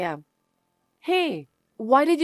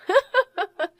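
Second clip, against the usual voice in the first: high, bright burst of laughter; laugh, openly delighted; triumphant little laugh; gleeful laugh; 10 semitones higher than usual. Acted. Wife delighted at divorce threat.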